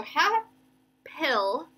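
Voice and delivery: "hap-pil" slow, long gap in the middle; split syllables. Shown as a demonstration. Teacher splitting double consonants, "hap-pil" in two slow pieces. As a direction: pause firmly between "hap" and "pil". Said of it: "we can break up the consonants".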